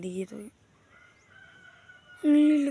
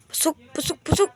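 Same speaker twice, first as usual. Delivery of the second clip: calling pigeons; 8 semitones higher, 7 dB louder than usual. Real, not acted.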